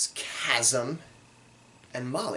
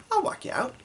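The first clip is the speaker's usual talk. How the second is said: put-on much higher, sweet girlish voice; lightly voiced Molly, high and gentle; faster than usual. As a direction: offer it shyly like a hopeful girl. Molly's line quoted.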